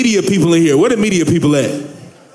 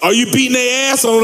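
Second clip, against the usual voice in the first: ordinary talk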